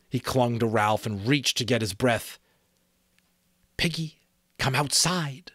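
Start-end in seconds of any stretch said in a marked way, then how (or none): 3.8-5.5 lightly voiced creepy call, higher; coaxing from the darkness; eerie lure. A narrator's reading. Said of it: "voice whispered horribly".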